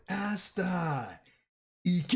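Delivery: high yelled Japanese battle cry calling Asta; screaming it in Japanese. Retold or imitated. Fan redoes the captain's scream in Japanese, high yell. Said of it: "let me say it in Japanese".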